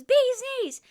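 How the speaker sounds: high, loud excited yell; calling out. Retold or imitated. Gucky spotting the bee tattoos, shouting high and loud. Retold shout, told a second time.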